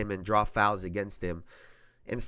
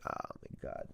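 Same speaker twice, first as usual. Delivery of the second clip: exasperated whisper; 21 dB quieter than usual. Real, not acted. Exasperated aside after rant.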